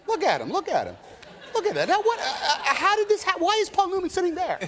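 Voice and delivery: high, giddy, stammering disbelief; hammed-up high-pitched awe at a star; starstruck gushing. Actor acts out gawking at a movie legend on set, starstruck. Acting out awestruck actors spotting Newman.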